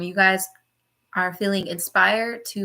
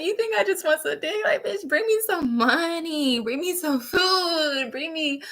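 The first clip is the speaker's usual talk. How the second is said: high, laughing sassy comeback; sassy mock rant; 5 semitones higher than usual. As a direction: scoff at him between laughs. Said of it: "literally, like".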